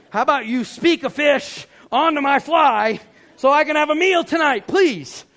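loud, high-pitched demand to God; exasperated plea. Retold or imitated. Fisherman begging God for a fish, loud exasperated plea. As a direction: plead loudly in exasperation.

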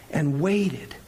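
ordinary delivery

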